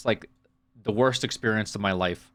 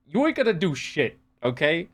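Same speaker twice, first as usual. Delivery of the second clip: higher, heated mock indignation; mock outrage; 5 semitones higher than usual. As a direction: fire back in fake fury. Mock outrage answering British chatter.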